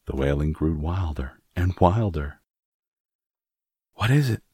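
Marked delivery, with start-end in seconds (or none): 3.9-4.4 higher, alarmed and breathless; startled, frightened asking; gasped question. A narrator's reading. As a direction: gasp it out in alarm. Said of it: "Miss Lennox gasped".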